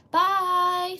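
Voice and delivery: usual speaking voice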